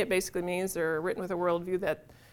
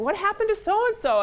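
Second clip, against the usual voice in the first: higher, louder eager question; mock eager reader; 13 semitones higher, 6 dB louder than usual. Reader pestering the author about a character, mock eager. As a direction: squeal it like an eager fan asking. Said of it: "they ask me".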